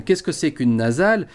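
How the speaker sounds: ordinary talk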